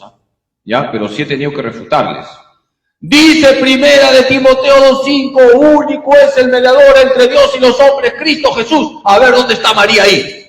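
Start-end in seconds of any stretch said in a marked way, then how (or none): 3.0-10.5 higher, louder mocking challenger quoting scripture; imitating an evangelical confronting a priest; challenging evangelical. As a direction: throw the verse at him like a challenge. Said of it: "Así te dicen".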